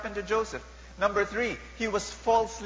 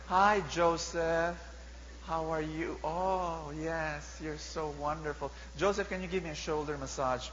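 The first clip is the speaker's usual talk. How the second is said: seductive Missus Potiphar; 2 semitones lower, 7 dB quieter than usual. Acted. Preacher acting Potiphar's wife coaxing Joseph, soft and seductive. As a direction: purr it like a bored lonely lady of the house. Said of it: "And Missus Potiphar said".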